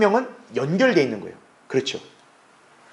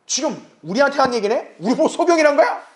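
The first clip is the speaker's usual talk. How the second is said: higher, indignant mock protest; offended retort; 6 semitones higher than usual. Pharisees bristle at Jesus, higher indignant retort. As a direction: play the stung Pharisees, taking offence. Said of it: "알아들으니까 발끈하는 거죠".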